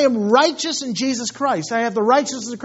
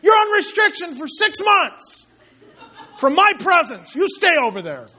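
shouting, much higher, hammed-up angry dad; mock enraged father yelling; furious earthly father; 7 semitones higher than usual. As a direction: rant like a fed-up father sending a kid away. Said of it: "He's not like".

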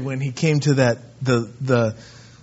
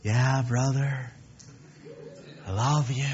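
soft-spoken Mister Love; 3 semitones lower, 9 dB quieter than usual. Acted. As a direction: murmur it like an overly gentle softie. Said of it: "Mister Love that's really soft-spoken".

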